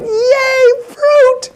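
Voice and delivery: put-on very high, squealing child voice; little kids cheering. Little kids hearing about fruit, high-pitched cheer. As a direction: squeal it like delighted small children. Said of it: "all the little kids went".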